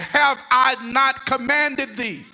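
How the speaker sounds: slow, loud, commanding God. Acted. Preacher voicing God to Joshua, slow and commanding. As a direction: boom it out slowly as God.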